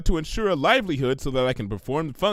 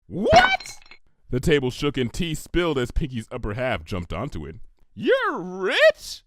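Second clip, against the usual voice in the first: shocked squeal. Acted. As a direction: leap up in amazement. Pinkie learns friend is rich.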